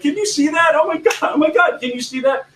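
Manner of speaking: high, thrilled gasping exclamation; excited. Retold or imitated. Presenter showing the crowd something, excited. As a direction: gush like you just spotted something amazing. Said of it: "They got really excited".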